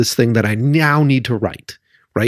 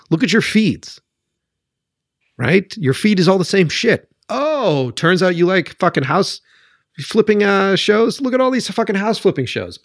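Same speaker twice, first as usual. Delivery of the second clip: getting mad; 6 semitones higher than usual. Real, not acted. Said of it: "I'm getting mad".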